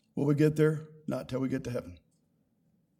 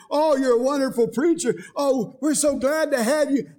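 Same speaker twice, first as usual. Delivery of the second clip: high, loud gushing praise; flattering church member; 8 semitones higher, 4 dB louder than usual. Retold or imitated. Churchgoers flattering the new pastor, gushing. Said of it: "pat you on the shoulder and say".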